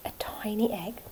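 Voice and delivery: hushed, tiny egg whisper. Real, not acted.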